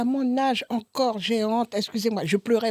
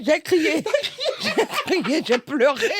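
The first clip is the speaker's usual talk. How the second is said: laughing; 6 semitones higher, 4 dB louder, slower than usual. Real, not acted. Laughing retelling of a blood test.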